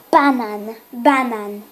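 banan with no final a, stress on first syllable; Slovak word. Shown as a demonstration. Child corrects herself, saying banan carefully. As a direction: say banan short, ending on the n. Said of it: "You say it's".

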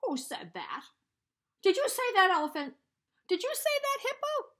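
loud high furious demand; angry. Acted. Warthog demanding who mocked him, loud angry. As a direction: shake with rage.

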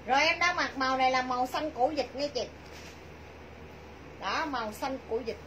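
ordinary delivery

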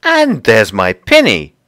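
loud, slow, emphatic exclamation; excited cry. Acted. Lucy spots her lost pinny, crying out excitedly. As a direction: cry out each word with delight. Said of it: "cried Lucy".

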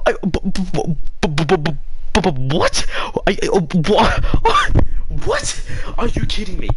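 stammering, high-pitched disbelief; flabbergasted stutter breaking into squeaks; speechless shock. Real, not acted. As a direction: sputter as if words won't come. Speechless at the game twist.

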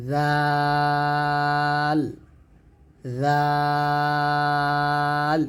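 জাল drawn out long and gentle, tongue tip at the teeth; Arabic letter dhal, sustained gently; gentle dhal held long. Shown as a demonstration. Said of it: "আর এটা হবে".

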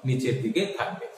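softly, letting the voice sink. Shown as a demonstration. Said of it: "তখন আমার স্বর".

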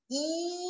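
soft high I pushing lips into a kiss; I puxando para biquinho; I gliding to rounded. Shown as a demonstration. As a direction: glide I towards ü. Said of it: "puxando pra fazer um beijinho".

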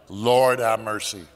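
ordinary talk

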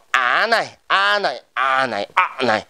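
"a" repeated with shifting tones; same vowel "a", different tone each time; varied tones. Shown as a demonstration. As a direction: give each "a" its own pitch shape. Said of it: "gần thì tất cả giống nhau hết".

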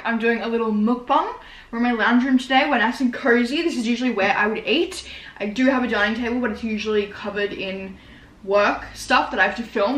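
usual speaking voice

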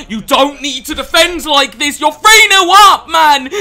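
loud, high-pitched angry rant; real frustration at full volume; exasperated shouting. Real, not acted. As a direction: yell at a stubborn opponent. Ranting at an opponent's defending.

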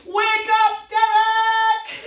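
big, high, sung-out shout of the name; loud shout. Shown as a demonstration. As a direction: bellow it to wake the puppet. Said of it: "ready, nice and loud".

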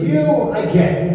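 high, confident verdict; a quick, sure pronouncement; retold approval. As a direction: hand down approval without hesitation. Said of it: "looks at Mickey and he goes".

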